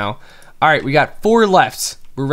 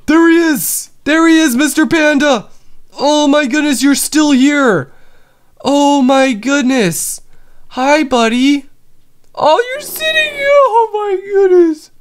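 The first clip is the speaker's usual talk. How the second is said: much higher, louder cooing squeal; squealing delight; 13 semitones higher, 5 dB louder than usual. Real, not acted. Gamer finding his panda still there, high cooing squeal. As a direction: squeal at him like a beloved pet.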